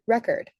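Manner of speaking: "record" stressed on its first syllable. Shown as a demonstration. Teacher giving an example of primary stress, first-syllable stress on "record". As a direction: hit the first syllable of "record", second one weak. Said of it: "the primary stress is on the first syllable".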